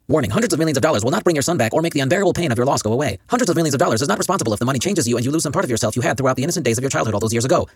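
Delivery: rapid fine print read; fast disclaimer. Acted. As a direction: rattle off the legal small print.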